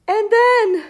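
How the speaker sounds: high and loud, voice catching on then; rising fear. Acted. Seed character dreads what happens next, fearful.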